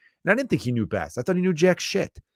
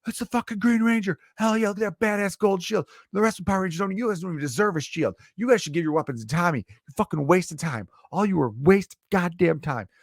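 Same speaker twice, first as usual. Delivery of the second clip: much higher, fired-up fanboy rant; hyped fan voice; 8 semitones higher than usual. Retold or imitated. Podcaster acting out his childhood self praising Tommy, high and hyped. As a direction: gush like a kid watching the show. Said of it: "And then it's like".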